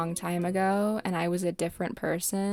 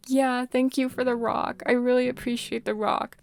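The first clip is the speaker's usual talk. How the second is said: retold tearful thanks, higher pitched; hammed-up weepy gratitude, pitched up; mock tearful; 5 semitones higher, 4 dB louder than usual. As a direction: imitate yourself thanking someone through tears. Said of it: "I was just crying worse".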